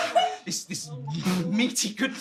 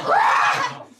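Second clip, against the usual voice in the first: high, loud creature screech; mock monster shriek; imitated alien roar; 7 dB louder than usual. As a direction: screech like a crazy Martian. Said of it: "They're like".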